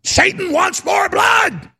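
high, slow, hammed-up demon shout; demonic voice. Host acting Satan demanding blood in a roar. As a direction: roar it like a hungry devil.

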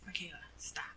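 whispered, half asleep; sleepy whisper. Retold or imitated. Half-asleep husband whispering to his wife, stop. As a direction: hush her from the edge of sleep.

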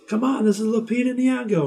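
high, insistent shouting TV host; mock shout. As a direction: wheedle the stranger at the top of the voice. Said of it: "And he goes".

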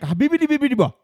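high, loud mock-foreign gibberish; mock gibberish reading. Mimics foreign writing on the card.

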